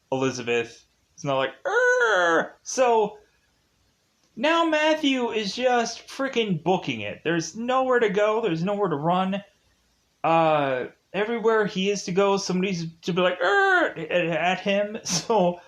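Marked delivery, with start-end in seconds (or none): 1.7-2.6 high-pitched mock alien screech; pod-person shriek. Imitating the other Elizabeth, alien shriek. 13.4-14.0 high mock screech; pod-person shriek. Pod people spotting Matthew, high shriek.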